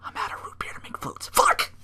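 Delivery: whispered then a sudden curse; mock despair over root beer; whispered dismay. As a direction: mutter it to yourself at the fridge. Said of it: "and I'm like".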